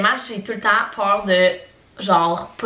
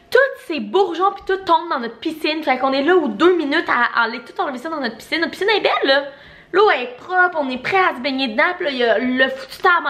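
higher-pitched, exasperated complaint; exasperated rant; 6 semitones higher than usual. Real, not acted. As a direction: vent about the tree shedding into the clean pool. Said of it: "ressentir de la haine envers un arbre".

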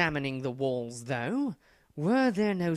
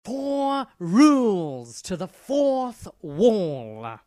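loud, drawn-out, higher announcement; emphatic proclamation; 4 semitones higher, 8 dB louder than usual. Acted. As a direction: proclaim it grandly to correct the confusion. Proclaims rules after confused echo.

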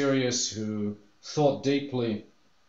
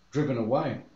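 ordinary talk, nothing marked

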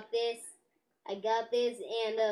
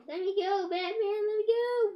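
high, louder desperate villain plea; pleading villain; 9 semitones higher, 4 dB louder than usual. Pretend. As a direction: beg to be let go. Joker pleads with Batman.

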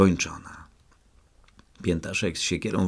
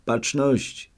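called command; 7 semitones higher than usual. A narrator's reading. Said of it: "zawołałem".

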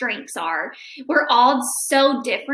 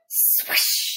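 whooshing sound. Acted. Sound effect of flight.

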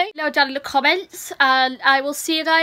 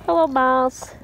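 ordinary delivery

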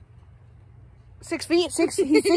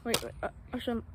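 ordinary talk, nothing marked

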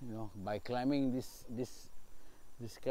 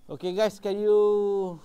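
louder and higher, fed up; frustrated louder outburst; exasperated outburst; 7 semitones higher, 9 dB louder, much slower than usual. Real, not acted. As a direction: snap at the noise.

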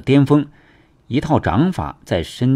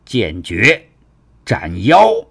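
sword-move battle cry, building louder; narrated warrior's shout, swelling at the end; 4 dB louder, slower than usual. A narrator's reading. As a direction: call out the attack, driving hard into the last word. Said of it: "大喝道".

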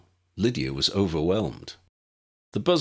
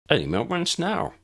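put-on pompous boy voice; 11 semitones higher than usual. A narrator's reading. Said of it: "Eric repeated".

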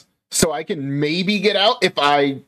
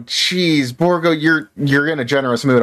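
normal talking voice

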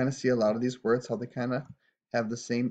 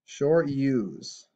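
ordinary delivery

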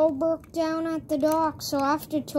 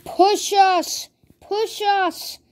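higher-pitched, urgent mock shout; acted calling out; 5 semitones higher, 4 dB louder than usual. The cars begging to be pushed, acted calling out. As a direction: call out in short, punchy bursts.

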